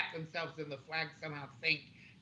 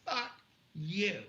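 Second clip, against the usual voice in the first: higher, deliberate two-word retort; defiant retold curse; 5 semitones higher than usual. Shopper retelling the answer to the manager, defiant. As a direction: repeat the insult slowly, pitched up.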